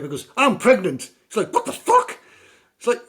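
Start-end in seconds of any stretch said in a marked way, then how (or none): 0.4-1.1 high, loud announcement; mock girl voice. Film girl telling the man she is pregnant, high and loud. 1.5-2.2 very high, loud squeak of disbelief; shocked outburst. Retold or imitated. Man hearing his partner is pregnant, shrieking high in shock.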